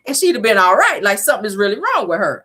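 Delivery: high, exasperated disbelief; high sassy disbelief; incredulous sass. Retold or imitated. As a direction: roll your eyes at the excuse. Her own retold reaction.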